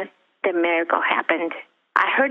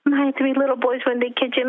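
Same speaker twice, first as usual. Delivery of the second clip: ordinary talk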